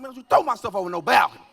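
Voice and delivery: loud, fast protest; fast yell back. Retold or imitated. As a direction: shout back quickly. Yelling back at wife.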